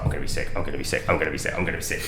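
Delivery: fast, low, soft panicked mutter. Retold or imitated. On a packed train, telling himself he's about to be sick, a fast low panicked mutter. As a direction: rattle it off under your breath, about to throw up.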